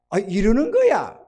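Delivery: ordinary talk, nothing marked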